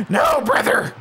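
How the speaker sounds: strained, high cartoon cry; badly faked high-pitched mushroom character; Toad. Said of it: "I can't do my Toad voice".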